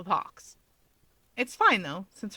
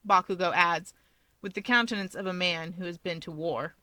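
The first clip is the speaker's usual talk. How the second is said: ordinary delivery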